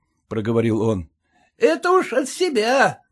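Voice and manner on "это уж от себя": pleading peasant. A narrator's reading. Peasant begging for more money.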